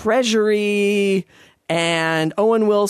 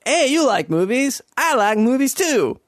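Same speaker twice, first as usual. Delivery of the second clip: pitched-up goofy boss; mock boss voice; 6 semitones higher than usual. Mock-eager boss voice to the storyteller. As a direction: play the boss as a dopey, eager guy. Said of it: "my boss at work said".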